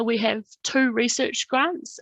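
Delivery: normal talking voice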